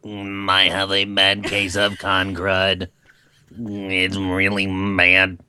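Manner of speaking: slow, dragged-out sick groaning; mock-sick voice. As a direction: play miserably congested, drawn out. Acting sick with con crud.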